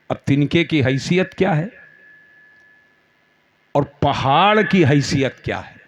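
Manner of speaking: ordinary talk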